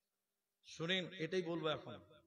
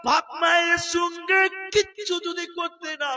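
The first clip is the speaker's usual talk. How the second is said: loud, slow, chanted sermon; full-force preaching; 21 dB louder, slower than usual. Acted. Preacher warning the crowd about neglected fathers, chanted preaching. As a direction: preach it with rising fervour, chanting the verses.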